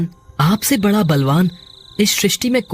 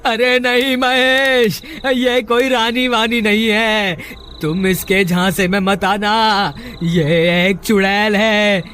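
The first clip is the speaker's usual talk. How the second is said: calling a warning. Acted. Trapped brother calls warning from well.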